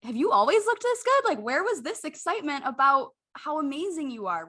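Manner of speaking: self-talk in the mirror, thrilled and high-pitched; excited self-praise. Retold or imitated. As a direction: gush at your own reflection. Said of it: "being like".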